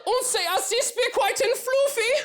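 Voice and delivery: hammed-up German accent, high sustained pitch; German shepherd. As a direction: lament in a squeaky mock German accent. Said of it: "impression of a German Shepherd".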